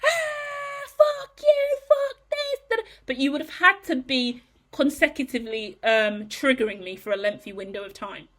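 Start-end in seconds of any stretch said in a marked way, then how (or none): 0.0-2.8 much higher, cry-like mock scream; a hammed-up outburst. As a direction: imitate her own tantrum. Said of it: "absolute fucking screaming".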